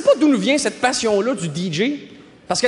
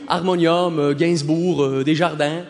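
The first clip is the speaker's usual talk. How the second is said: much lower, deliberate cool answer; mock-serious lying; 7 semitones lower, slower than usual. Faking a first-album answer, mock-serious low voice. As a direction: list them low, as if cultured. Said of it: "J'ai toujours répondu".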